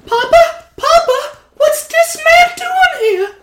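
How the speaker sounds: put-on very high squeaky kid voice, slowing on the question; a tiny kid calling to his father; small child.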